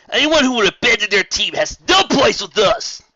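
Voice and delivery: high, loud accusing shout; loud condemnation. Acted. Wonderbolt condemning Rainbow Dash, loud shout. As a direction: shout her out of the team.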